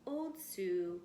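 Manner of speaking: ordinary delivery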